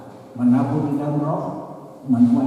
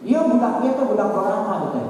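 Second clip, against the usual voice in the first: higher-pitched mock complaint from a mother; lightly voiced complaining mother; 8 semitones higher than usual. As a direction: lightly act out an old mother's grumble. Elderly mother's complaint acted out.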